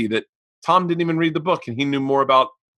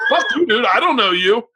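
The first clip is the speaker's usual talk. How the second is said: mock snitching retort; 6 semitones higher than usual. Acted betrayal retort.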